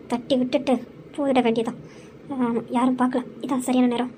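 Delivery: scheming aside. Acted. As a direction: mutter the plan under the breath like a schemer. Villain plots aside in secret. (தாழ்ந்த குரலில் மெதுவாக சதி திட்டம்)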